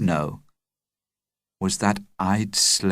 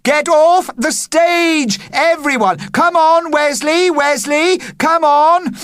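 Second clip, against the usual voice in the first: urgent shouting; 6 dB louder than usual. Acted. Adult yelling urgently.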